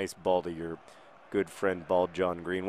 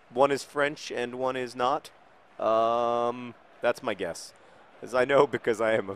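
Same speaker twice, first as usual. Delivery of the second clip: ordinary talk, nothing marked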